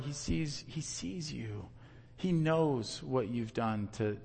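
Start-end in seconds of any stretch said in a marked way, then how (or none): 0.0-1.7 much softer and slower, intimate; hushed and tender. Real, not acted. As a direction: let it drop to a near hush. God sees your heart.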